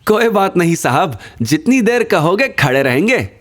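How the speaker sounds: high character voice, readily obliging; rickshaw driver. A narrator's reading. Happy rickshaw driver agreeing to wait. (ऊँची आवाज़ में राज़ी होता rickshaw वाला)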